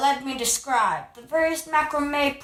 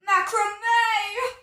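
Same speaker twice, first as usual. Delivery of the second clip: gushing delight; 7 semitones higher than usual. Acted.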